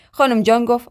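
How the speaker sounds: usual speaking voice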